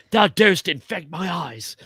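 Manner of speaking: high, theatrical Shakespearean delivery; dramatic stage voice. Shown as a demonstration. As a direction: declaim it like a stage actor. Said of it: "in dramatic stage fashion".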